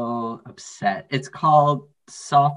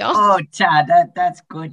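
mock Minnesota moaning; 4 semitones higher, 7 dB louder, slower than usual. Joking Minnesota Todd bit.

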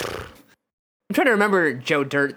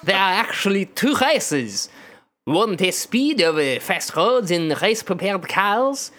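laboured, thick mock French accent; exaggerated French accent. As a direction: explain it as a caricature French official. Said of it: "words than I thought I would have to say in this accent".

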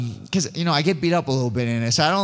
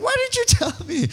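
high, incredulous squeal of surprise; a mock-outraged why didn't you tell me; freaking out; 3 dB louder than usual. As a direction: shriek it in happy disbelief. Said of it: "I'm freaking out".

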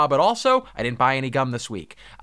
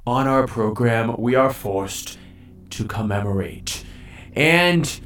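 announcer voice; 4 semitones lower, slower than usual. Acted. Hosts the show's award segment.

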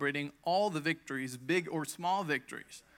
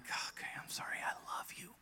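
whispered apology to her leg; tender whisper; 7 dB quieter than usual. Retold or imitated. Patient whispering sorry to her own leg. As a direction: whisper it lovingly. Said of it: "speak to it tenderly".

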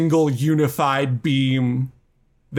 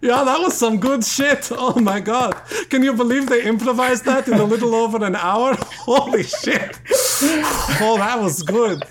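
high, gushing amazement; over-the-top awestruck raving; hyped fan reaction; 10 semitones higher than usual. Pretend. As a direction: gush in a high-pitched voice. Hyped reaction to finished improvisation.

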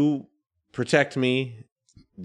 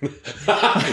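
loud guffaw; 4 semitones higher, 3 dB louder than usual. Acted. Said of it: "You sound like the cow".

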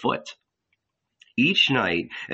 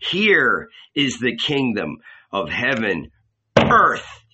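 passionate cry; 5 dB louder than usual. Acted. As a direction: pound the ground and shout it. He banged his fist.

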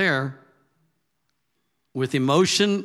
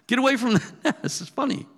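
laughing; 11 semitones higher, 3 dB louder, faster than usual. Real, not acted. Laughing at killdeer antics.

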